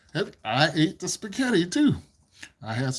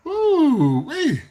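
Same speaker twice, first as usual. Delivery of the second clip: sudden surprise; 11 semitones higher, 6 dB louder than usual. Real, not acted. Sudden notice on stream.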